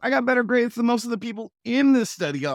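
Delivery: high, loud indignant talk-back; defiant teen. Retold or imitated. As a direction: argue back, proud and offended. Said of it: "I was like".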